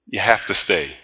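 a lightly voiced serious doctor. Doctor tells the pastor he must stay, low and grave. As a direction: drop into a solemn doctor's tone.

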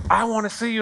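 high, insistent mock tirade; imitated upset parent. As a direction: act out an irate parent laying down threats. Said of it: "Why are you so upset?".

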